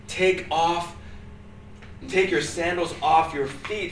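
scripture reading. Acted. Reading Exodus aloud.

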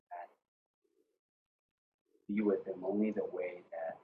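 soft, low prayer voice; hushed prayer. Real, not acted. Pastor praying for a church family, hushed prayer. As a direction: pray quietly, with halting pauses.

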